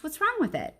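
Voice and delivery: high, puzzled little dog voice; Bob's voice. Acted. Bob the dog questioning Ivan's painting, high and puzzled. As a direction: ask it as the skeptical small dog. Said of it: "Bob says I'm crazy".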